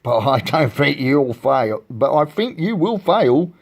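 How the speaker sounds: put-on villain bird's taunt; sneering. A narrator's reading. Eagle to Horton about his search, sneering. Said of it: "sneered the bird".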